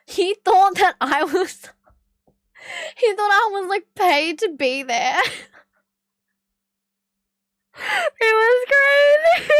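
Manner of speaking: high voice breaking into real laughter; helpless giggles taking over the words; cracking up. Real, not acted. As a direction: struggle to get it out through laughing. Cracking up at her own story.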